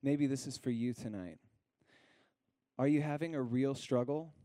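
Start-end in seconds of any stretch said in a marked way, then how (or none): 2.8-4.3 scripture reading. Acted. Pastor to congregation, gently reading scripture aloud. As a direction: read it like a gentle invitation.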